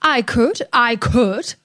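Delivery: a mildly cross huff, repeated; huffed crossly. A narrator's reading. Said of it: "huffed Bertie crossly".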